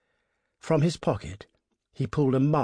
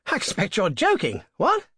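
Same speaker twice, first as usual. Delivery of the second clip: higher, louder, incredulous gentleman's voice; bluffing young toff; 9 semitones higher, 4 dB louder than usual. A narrator's reading. Motorist protesting to the highwayman, high and incredulous. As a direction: laugh it off as an outrage.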